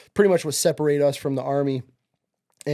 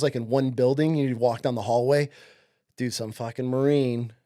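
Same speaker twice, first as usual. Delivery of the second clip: ordinary delivery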